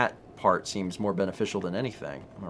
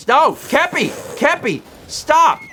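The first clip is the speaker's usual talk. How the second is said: shouting; 10 dB louder, much slower than usual. Real, not acted. Horse runs off, calling.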